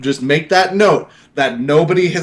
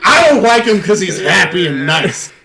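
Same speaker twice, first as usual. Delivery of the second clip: a higher, laughing send-up of a grouch; mocking grumpy old man; 6 semitones higher than usual. Teasing Jason by mimicking him, laughing mock grouch. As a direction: tease him by mimicking his grumbles. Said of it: "grumpiest old fuck".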